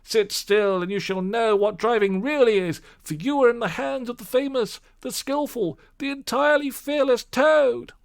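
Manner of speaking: high, loud swaggering boast; boastful shout. Acted. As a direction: crow about yourself with glee. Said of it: "he shouted recklessly".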